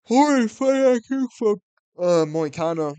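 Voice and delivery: furious fan yelling about fight IQ; outraged yell. Real, not acted. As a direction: shout it in disgust.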